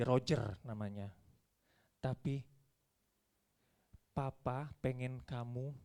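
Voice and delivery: low, soft, tender fatherly talk; loving father. Acted. Father lovingly explaining to his scolded child, low and tender. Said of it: "Saya dengan kasih panggil anak saya".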